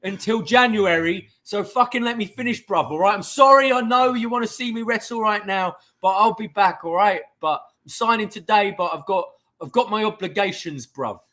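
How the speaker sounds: high, loud cocky London bruv voice; Ospreay impression. Mimicking Ospreay's cocky promo in a loud London accent. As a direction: mouth off like a brash British wrestler cutting a promo. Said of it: "every time he talks like this".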